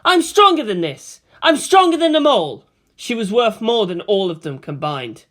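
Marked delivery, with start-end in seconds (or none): 0.0-2.6 higher, louder defiant cry; inner shout. A narrator's reading. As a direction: shout it to steel yourself. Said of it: "she shouted deep into herself".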